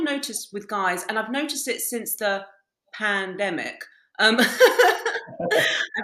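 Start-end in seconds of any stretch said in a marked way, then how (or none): 4.4-5.3 high, loud burst of laughter; real laugh. Real, not acted. Podcast guest cracks up after mentioning the pandemic, laughing. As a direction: laugh out loud mid-thought.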